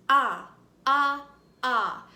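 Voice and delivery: AH said loud as short O in octopus; short O sound. Shown as a demonstration. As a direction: belt out AH like the start of octopus. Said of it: "Say it with me".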